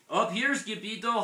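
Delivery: high, loud call from above; hollering. A narrator's reading. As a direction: holler down to a friend. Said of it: "hollered the Chihuahuas".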